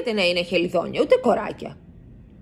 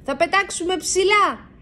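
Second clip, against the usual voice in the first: higher bat voice calling a warning; 12 semitones higher than usual. A narrator's reading. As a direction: bats telling riders to hold tight. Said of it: "φωνάζουν".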